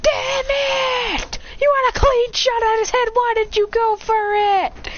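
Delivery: high, loud frustrated yelling; squealed, exasperated outburst; gamer rage. Acted. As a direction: screech at a teammate who blew it. Gamer rages at failed teammate.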